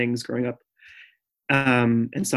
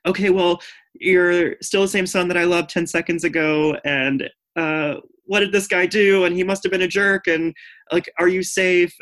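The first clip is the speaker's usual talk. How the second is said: protective mom; 6 semitones higher than usual. Retold or imitated. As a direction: lightly act out a worried, protective mother. Mom reacting protectively on phone.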